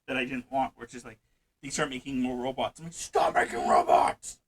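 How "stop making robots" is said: loud, drawn-out mock-furious yell; exasperated shout. Yelling at robot-building factories.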